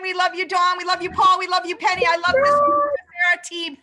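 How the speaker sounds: high, loud shout-outs to the team; affectionate cheering. Real, not acted. Host cheering the team as the event ends, affectionate shouting. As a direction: call it out loud and high, name after name. Calling out love to departing colleagues.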